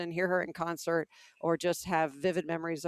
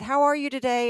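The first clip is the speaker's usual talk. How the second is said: cheerful waiter greeting; 7 semitones higher, 7 dB louder than usual. Retold or imitated. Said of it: "and he said".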